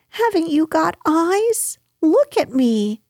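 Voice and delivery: high-pitched indignant cry; exasperated Piglet. A narrator's reading. Piglet demands Kanga look at him, exasperated. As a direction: demand attention in a small, high voice. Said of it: "shouted Piglet".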